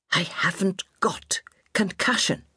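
hushed, slow retort. A narrator's reading. Injured old lady to her nurse, hushed retort. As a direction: snap back under your breath. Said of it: "Miss Bellamy retorted".

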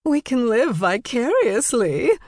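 high, tipsy, tittering delivery; gleeful gossip, pitched high; giggly. A narrator's reading. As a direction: say it with a touch of giggle. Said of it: "Jane giggled".

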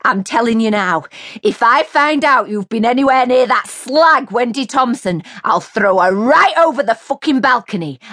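high, loud, mounting rage; jealous furious threat. A narrator's reading. Mary threatening Jay over Wendy, furious. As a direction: threaten him, building to a scream.

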